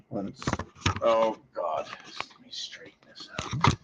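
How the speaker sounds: whispered frustrated aside; under breath whisper. Real, not acted. Teacher fumbling with the screen, whispering to herself. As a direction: mutter it to yourself, nearly silent.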